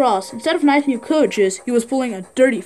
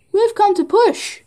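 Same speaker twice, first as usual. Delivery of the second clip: higher, slower, we've come to help; cheerful helper; 8 semitones higher, slower than usual. Acted. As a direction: announce the rescue brightly. Driver arrives to help.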